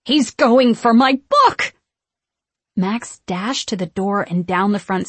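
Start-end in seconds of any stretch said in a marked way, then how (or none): 0.1-1.7 loud, drawn-out alarmed cry. A narrator's reading. Winifred seeing Max grab the book, crying out. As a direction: cry it out high and slow, rising. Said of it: "cried Winifred".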